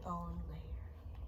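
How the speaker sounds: soft unvoiced whisper. A narrator's reading. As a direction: breathe it in a hushed whisper. Whispered story ending.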